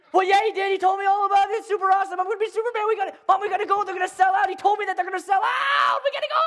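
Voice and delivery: put-on high, loud child begging; hammed-up little boy desperation; frantic kid. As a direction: plead nonstop, climbing to a squeal. Child frantically pleading with mother.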